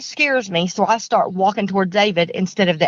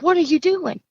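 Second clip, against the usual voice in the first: alarmed question; 9 semitones higher than usual. Retold or imitated. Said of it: "I'm like".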